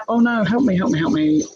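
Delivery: retold call for help. Calling nurse after catheter popped.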